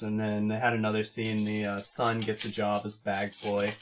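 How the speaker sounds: ordinary delivery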